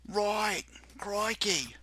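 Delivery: high-pitched mock Australian; Australian accent. Host teased over his British accent, exaggerated Australian accent. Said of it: "That was Australian".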